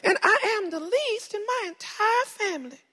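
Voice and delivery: put-on very high southern-accented character voice. Gideon making excuses to the Lord, high southern accent. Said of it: "I gave him a southern accent".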